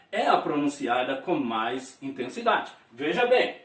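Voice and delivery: ordinary talk, nothing marked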